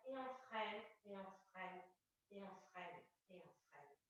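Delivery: very soft, low, fading repetition; hushed easing down. Real, not acted. Coach braking the class's descent, hushed and sinking.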